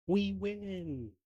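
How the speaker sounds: high, drawn-out cheer of victory; triumphant cry. Acted. Player voices a lawyer after the court is adjourned, triumphant cry. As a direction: cheer the win on one long high note.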